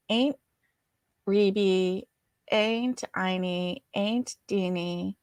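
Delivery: "Aunt Reby, Aunt Inie, Aunt Deanie" with "Aunt" as "ain't"; family pronunciation. Shown as a demonstration. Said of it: "usually turned into E's".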